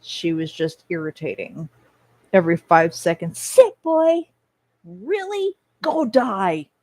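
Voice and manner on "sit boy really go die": mocking imitation. Imitating Kagome, then mocking her.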